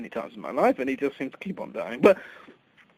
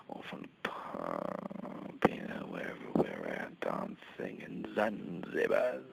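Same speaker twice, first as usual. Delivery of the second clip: seductive whisper; 8 semitones lower, 10 dB quieter than usual. Acted. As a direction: murmur it like a sleazy seduction. Seductive story of bringing women home.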